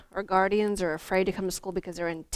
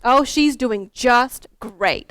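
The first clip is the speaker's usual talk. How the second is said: slow, loud encouraging teacher voice; reassuring teacher; 4 semitones higher, 11 dB louder, much slower than usual. Retold or imitated. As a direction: cover for a shy pupil warmly. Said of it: "she's like".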